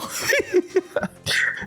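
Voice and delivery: squeaky airy burst of laughter; high breathy laugh. Real, not acted. Narrator cracking up over how weird Mel looks, high airy laugh.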